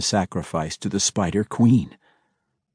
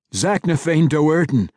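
yelling back; 6 semitones higher than usual. A narrator's reading. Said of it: "Drizzt yelled back".

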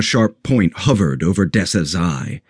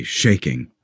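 ordinary delivery

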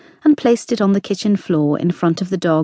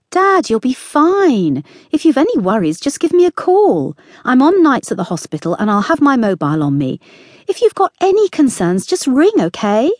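higher, a little louder, coaxing daughter; lightly voiced daughter; 6 semitones higher, 3 dB louder than usual. A narrator's reading. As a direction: reassure a stubborn dad, pitched up. Sammy reassures her father.

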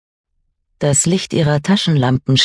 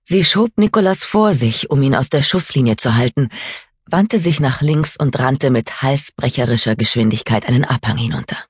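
usual speaking voice

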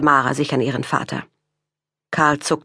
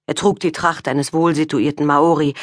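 ordinary delivery, nothing marked

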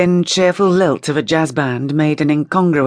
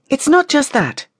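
high, insistent voice; insistent accusation; 10 semitones higher, 3 dB louder than usual. A narrator's reading. Rachel goes on against Violette, insistent. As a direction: press the point, pitched well up.

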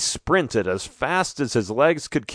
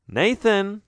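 put-on higher girl voice calling; 9 semitones higher than usual. A narrator's reading. Girl says name again.

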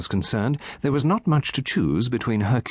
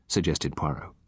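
plain reading voice

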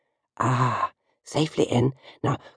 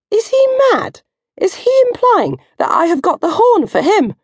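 outraged disbelief. Acted. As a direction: squeal in scandalised shock. Outraged at Mark's remark.

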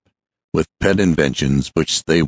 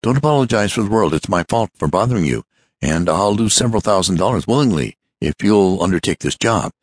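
fast, higher, tumbling words; rapid tones; 4 semitones higher than usual. Acted. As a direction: rush it out without pausing for breath. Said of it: "in rapid tones".

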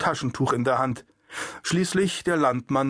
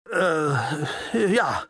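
flustered. Acted. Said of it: "überspielte seine Unsicherheit".

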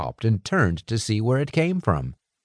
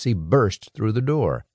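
plain reading voice

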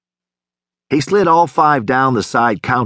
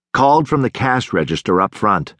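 plain reading voice

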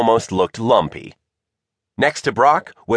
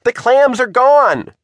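high, loud, slow statement; exasperated insistence; 13 semitones higher, 5 dB louder than usual. A narrator's reading. Margot tells screaming Shivers it is over, exasperated. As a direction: spell it out loudly and slowly.

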